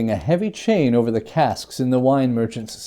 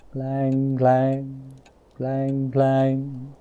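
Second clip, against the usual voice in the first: a softer tolling bell; soft bell sound; much slower than usual. Acted. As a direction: let the bell ring faintly, barely there. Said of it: "It swung so softly in the outset".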